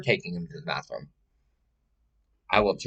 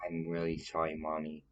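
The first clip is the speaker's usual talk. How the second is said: soft, quieter, really meaning it; a child's soft, sincere sorry to mommy; sincere apology; 10 dB quieter than usual. Pretend. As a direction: say sorry like you truly mean it. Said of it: "Say it like you mean it".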